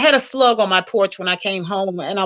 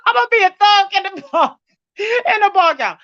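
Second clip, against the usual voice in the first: teary laughter; 10 semitones higher than usual. Real, not acted. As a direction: laugh through real tears. Moved to tears by the compliment.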